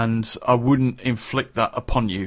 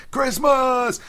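high, gleeful Christmas cry; excited Christmas cry; 12 semitones higher than usual. Real, not acted.

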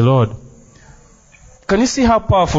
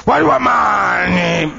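mock shouting preacher; much slower than usual. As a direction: belt it out slowly, parodying a sweaty revivalist. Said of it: "spits and shouts and sweats".

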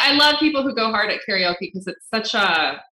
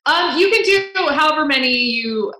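normal talking voice

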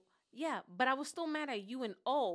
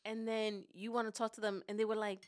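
ordinary talk

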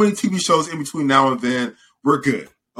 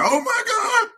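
high-pitched pretend panicked cry; mock panic. Imagining the office staff with heads on fire, mock panic. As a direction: play-act an office worker in a frenzy.

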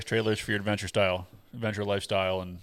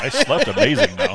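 mock blissful sleeper; 6 dB louder than usual. Joking about drifting downstream asleep.

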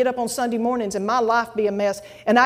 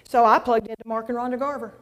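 normal talking voice

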